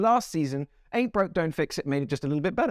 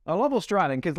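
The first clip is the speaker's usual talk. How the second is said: ordinary talk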